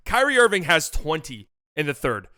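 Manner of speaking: normal talking voice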